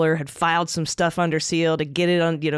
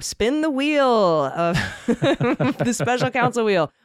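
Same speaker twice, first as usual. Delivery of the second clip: slow, booming game show host, laughing; booming prize announcer; 6 semitones higher, much slower than usual. Pretend.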